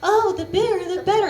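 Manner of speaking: high, chirpy "bigger, the better"; mock friend gushing. Friends who love big ones, high mock gushing. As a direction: gush it up high like a naive friend. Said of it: "I have some friends who say".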